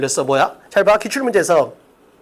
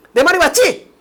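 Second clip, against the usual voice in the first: higher-pitched push for agreement; mildly self-assertive; 5 semitones higher than usual. Acted. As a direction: insist you are right, lightly acted. Acting a self-assertive seminar speaker. (살짝 우기듯이)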